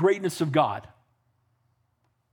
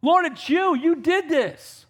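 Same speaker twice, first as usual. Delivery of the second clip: crying out; 8 semitones higher than usual. Acted. Solomon on his knees, crying out to God in praise. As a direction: call out to the Lord, slowing on the last line. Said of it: "He's crying out to him".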